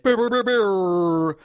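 high, drawn-out trilled "brr"; mouthed fanfare noise. Pretend. Host kicks off Trash It or Stash It, mouthed fanfare noise. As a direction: imitate a jingle with brr sounds. Jingle for a segment start.

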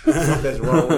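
real laughter through the words; laughing while telling the fake illness. Real, not acted. Laughs at his own fake illness.

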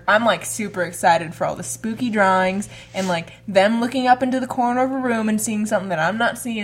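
ordinary talk, nothing marked

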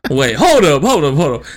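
mock disbelieving interruption. Retold interrupting mom in disbelief.

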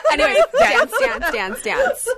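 high, laughing singsong echo of her line; laughing mock repeat. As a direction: giggle through the words, teasing. Mocking repeat of friend's line.

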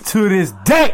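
loud, high yelp on DAY; Deontay Wilder. Acted. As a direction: boxer impression, punch DAY up high. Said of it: "What my man Deontay Wilder say?".

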